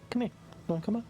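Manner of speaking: high coaxing call to a pet; talking to a dog. Real, not acted. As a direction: sweet-talk the dog up the stairs. Calling the dog upstairs.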